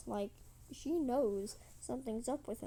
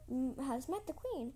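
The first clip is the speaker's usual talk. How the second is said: ordinary delivery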